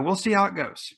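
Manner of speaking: "we'll see how it goes" lifted high, with "how" on top then falling. Real, not acted. Repeats closing line differently.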